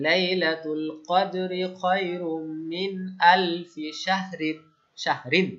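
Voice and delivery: Quran recitation. Shown as a demonstration. Teacher recites a Surah Qadr verse for the class. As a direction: recite the verse slowly in tajweed style. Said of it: "আমরা দেখি সূরা কদরের একটা আয়াত".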